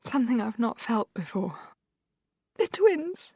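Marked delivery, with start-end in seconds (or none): 2.6-3.3 high, sudden panicked cry; a frightened mother's sudden yelp; fearful yelp. A narrator's reading. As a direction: yelp in fear for her children. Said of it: "she yelps".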